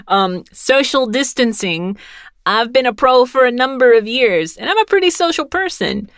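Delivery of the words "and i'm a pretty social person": ordinary talk, nothing marked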